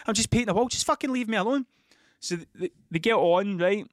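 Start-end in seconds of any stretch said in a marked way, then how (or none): 0.1-1.7 exasperated. Retold or imitated. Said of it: "other times it's like".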